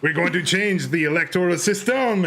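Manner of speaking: put-on a light touch of a Quebec French accent; Jean Chrétien impression. Retelling the former prime minister's promise, in a light Quebec accent. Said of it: "He's like".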